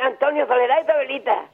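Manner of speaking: ordinary talk, nothing marked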